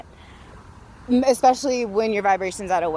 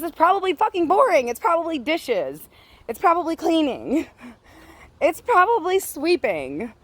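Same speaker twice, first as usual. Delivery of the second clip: worked-up rant; 8 semitones higher than usual. Real, not acted. Ranting about small talk.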